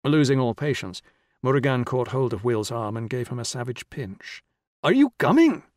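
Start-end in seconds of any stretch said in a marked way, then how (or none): 4.8-5.6 high, loud shouted question; impatient shout. A narrator's reading. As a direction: shout it, all patience lost. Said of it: "he shouted".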